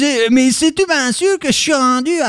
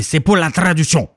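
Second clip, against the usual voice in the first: normal talking voice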